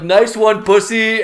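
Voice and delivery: loud, high-pitched jeer; mock heckle. Drunk employee to his manager, jeering.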